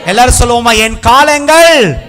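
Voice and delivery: high, slow shouted declarations building to Hallelujah; full-force preaching. Real, not acted. Preacher leading everyone in a declaration, loud drawn-out cries. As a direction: belt out each phrase for the crowd to echo.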